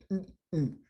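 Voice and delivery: high mock hum for tied hands; imitating gesturing hum. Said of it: "they'd be like".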